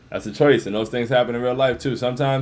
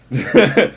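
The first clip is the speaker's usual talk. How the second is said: laugh; 3 semitones higher, 6 dB louder than usual. Real, not acted. Laughs at twelve viewers.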